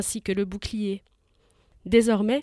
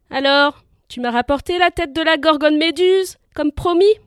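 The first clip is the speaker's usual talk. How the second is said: louder, higher mocking jeer; jeering taunt; 5 semitones higher, 8 dB louder than usual. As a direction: jeer at him before the crowd. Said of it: "le roi eut un rire grinçant".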